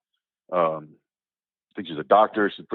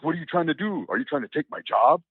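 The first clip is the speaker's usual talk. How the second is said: mock indignant imam; 7 semitones higher than usual. Imam grabbing mic from kid.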